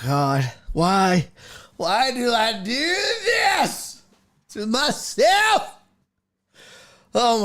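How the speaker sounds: high, drawn-out anguished complaints; agonized over his own project; exasperated groaning. Real, not acted. Streamer to chat about his broken code, anguished.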